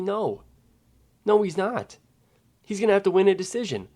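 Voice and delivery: fired-up fan insisting he won't get knocked out; worked-up insistence. Real, not acted. Heated fight prediction rant.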